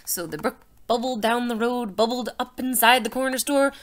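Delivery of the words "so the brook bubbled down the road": plain reading voice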